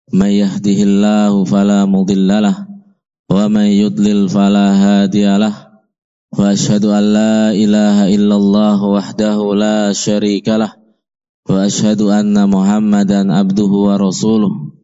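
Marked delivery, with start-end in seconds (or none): none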